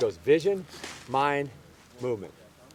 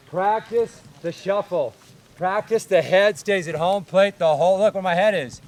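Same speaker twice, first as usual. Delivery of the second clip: coaching call; 3 semitones higher, 8 dB louder than usual. Shown as a demonstration. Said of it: "coach drilling fielders".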